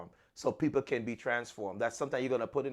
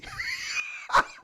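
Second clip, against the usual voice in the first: high laugh. Real, not acted. Laughs at own story.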